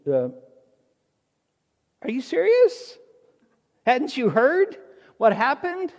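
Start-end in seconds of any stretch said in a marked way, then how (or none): 2.0-5.9 high, loud disbelief; can't believe he hasn't heard; incredulous Cleopas. Acted. Cleopas to the stranger, loud high disbelief. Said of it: "he says".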